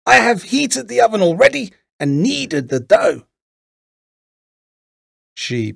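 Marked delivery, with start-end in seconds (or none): 0.0-3.3 put-on high, loud old-witch voice. A narrator's reading. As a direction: play the witch high and forceful. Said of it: "said the old woman".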